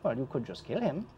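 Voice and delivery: put-on lilting accented host voice, pitch swooping up; theatrical ghost host, sly and courteous; ghostly host Alvin. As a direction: play a ghostly host welcoming a guest to a deadly game. Game master voices parlor host.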